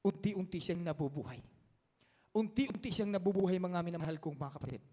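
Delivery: ordinary delivery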